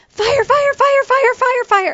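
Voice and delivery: very high, rapid repeated fire calls; frantic yelling. Retold or imitated. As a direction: keep shrieking fire over and over.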